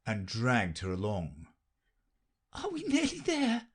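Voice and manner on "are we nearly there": panting Alice. A narrator's reading. Alice asks the queen while running, high and breathless. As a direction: push the words out between breaths. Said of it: "Alice managed to pant out".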